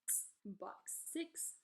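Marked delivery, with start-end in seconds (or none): 0.0-0.2 "X" as a bare ks; isolated "ks" for "X"; letter sound demo. Shown as a demonstration. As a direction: say "X" as the last sound of box. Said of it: "X makes a sound X".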